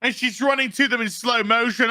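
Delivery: normal talking voice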